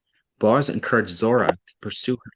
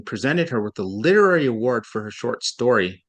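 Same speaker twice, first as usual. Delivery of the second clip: usual speaking voice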